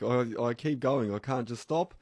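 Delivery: ordinary talk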